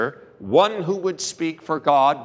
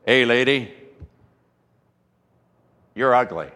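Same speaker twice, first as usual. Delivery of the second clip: lightly voiced parrot; 4 semitones lower than usual. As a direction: toss off the parrot's taunt lightly. Parrot taunts woman in story.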